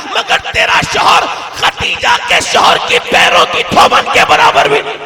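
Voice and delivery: high, shouted preaching at full force; furious rebuke. Acted. Preacher scolding the arrogant wife before the congregation, shouting. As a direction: thunder down at the proud woman.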